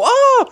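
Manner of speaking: sudden high shriek of fright; startled group scream. Retold or imitated. Guests at dinner table shrieking at a sudden chop. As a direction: shriek like everyone just jumped. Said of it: "and they all go".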